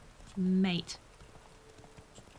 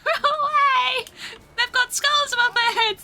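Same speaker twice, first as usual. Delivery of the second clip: high, loud panicky wail; frantic high cries while fleeing enemies; panicked shouting; 10 semitones higher, 5 dB louder than usual. Real, not acted. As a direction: urge the character to escape, shrieking.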